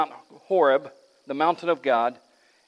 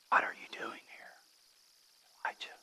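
soft, close whisper of the voice of God; gentle whisper; 12 dB quieter, faster than usual. Acted. God whispering to Elijah in the cave, hushed whisper. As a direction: breathe it as if right beside his ear. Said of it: "or a whisper".

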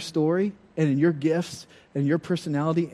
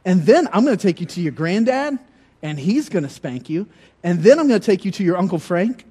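mom voice building louder and higher; threatening mom; 4 semitones higher, 5 dB louder than usual. Retold or imitated. Mother listing who will spank him, building higher and louder. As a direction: rise through the list of uncles with mock menace. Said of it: "She said".

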